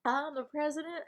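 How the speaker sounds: hammed-up Southern book club leader; improvised Southern character. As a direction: play a chatty Southern breakup-advice character. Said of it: "I'm just improving now for this character I created".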